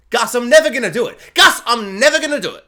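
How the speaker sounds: loud, high, laughing refusal; defiant shout. Retold or imitated. Imaginary roommate refuses Gus's toothbrush cleaning, loud laughing shout. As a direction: yell it back at him, half laughing.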